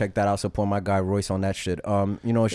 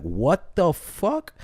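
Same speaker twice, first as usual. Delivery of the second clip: slow, drawn-out shocked question; stunned disbelief; 6 semitones higher, much slower than usual. Acted. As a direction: stretch it out in pure shock. Frog stung mid-river, in shock.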